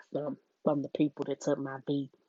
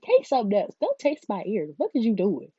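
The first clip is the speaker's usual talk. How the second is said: higher and louder, fed-up telling-off; exasperated scolding; 5 semitones higher, 8 dB louder than usual. Retold or imitated. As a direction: scold him like he's ridiculous. Scolding him in the retold scene.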